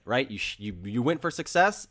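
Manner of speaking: ordinary talk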